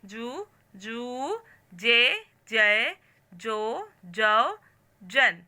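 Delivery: slow, spaced syllable-by-syllable reading; syllable drill. Shown as a demonstration. Teacher drilling Marathi syllables for the class, slow and clear. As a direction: call each sound out clearly for pupils to repeat.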